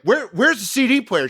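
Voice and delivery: high, loud frantic question; desperate to find it; high panicked where. Retold or imitated. Frantic search.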